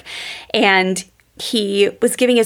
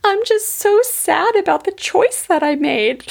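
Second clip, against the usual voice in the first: put-on high-pitched, childlike sad voice; a small child's sorrow, pitched up high; sad little boy; 11 semitones higher than usual. Boy telling his dad he regrets his choice, high childlike sadness. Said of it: "And his little boy said".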